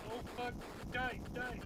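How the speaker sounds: shouting. Real, not acted. Frantic fight in game.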